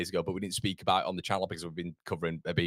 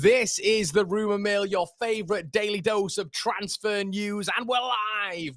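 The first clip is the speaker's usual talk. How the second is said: loud, high, stretched-out greeting; show-opening announcement; 12 semitones higher, 9 dB louder than usual. Real, not acted. Host welcomes viewers to the live daily show, big announcer shout. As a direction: belt it out like a hyped announcer.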